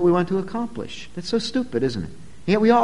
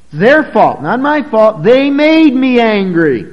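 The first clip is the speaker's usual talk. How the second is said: louder, higher, slow excuse-making; blame-shifting; 5 semitones higher, 9 dB louder, slower than usual. Retold or imitated. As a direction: insist it is someone else's fault, slowly. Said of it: "an attempt to pin the blame on somebody else".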